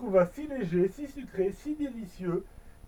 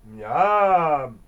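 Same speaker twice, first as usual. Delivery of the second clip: loud lower-pitched yum of delight; 6 semitones lower, 9 dB louder than usual. Acted. Elf tasting the stolen cake, delighted yum. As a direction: savour it out loud.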